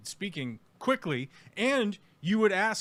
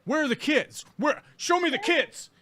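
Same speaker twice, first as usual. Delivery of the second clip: mock nagging interrogator; 8 semitones higher than usual. Streamer imagines hounding the reporter on his own property. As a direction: badger him over and over. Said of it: "start asking you fucking crazy questions".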